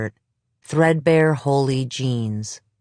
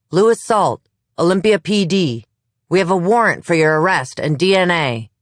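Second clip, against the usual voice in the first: loud, high announcement through a door; police call-out; 5 semitones higher, 5 dB louder than usual. Acted. Officer shouting the warrant through Salt's door, loud and high. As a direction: bark the warrant at the house.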